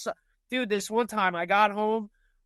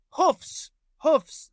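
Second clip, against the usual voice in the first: scared insistence; 7 semitones higher, 4 dB louder than usual. Retold or imitated. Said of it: "She was like".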